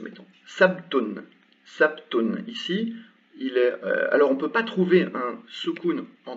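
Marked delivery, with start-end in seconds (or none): 0.5-2.4 sabtun with bare b, no vowel; slow sabtun, the b closed; sukun demo. Shown as a demonstration. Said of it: "on va dire comme ça". (sabtun lentement, sans voyelle sur le b)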